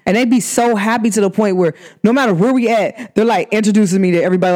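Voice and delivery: ordinary delivery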